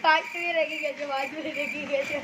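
ordinary delivery

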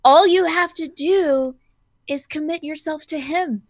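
measured, lilting evangelist reassurance; mock preacher. Snoopy follower to kneeling convert, soothing mock sermon. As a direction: draw out the promise of salvation.

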